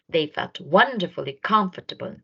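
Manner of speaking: ordinary delivery, nothing marked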